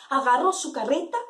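plain reading voice

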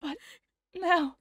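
voicing dying Kurisu. Voicing Kurisu confessing about her father, tearful. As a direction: play the dying girl, pained and upset.

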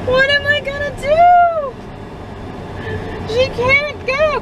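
high wailing lament; mock despair. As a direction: whine it like a heartbroken dad. Dad hams up despair over school.

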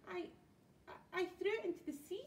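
high-pitched stammered admission; nervous stammer. A narrator's reading. Troll answering four angry pirates, nervous stammer. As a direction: stammer the confession out.

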